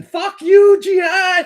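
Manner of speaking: high, loud far-off shouted curse; imitating a taunting yell. Enemy soldier taunting from the jungle, hammed-up shout. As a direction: scream the taunt across the dark. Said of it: "you can hear him listening".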